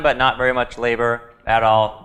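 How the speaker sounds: normal talking voice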